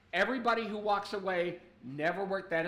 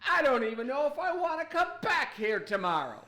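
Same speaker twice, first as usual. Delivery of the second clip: raised, higher-pitched mock indignation; John Taffer exasperated; 5 semitones higher than usual. Bar fixer threatening the staff he'll walk out, mock exasperation. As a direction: play up a theatrical threat to quit. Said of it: "He goes".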